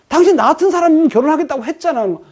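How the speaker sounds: high, pressing reproach; accusing outburst. Acted. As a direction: throw the promise back at them. Stalker accusing.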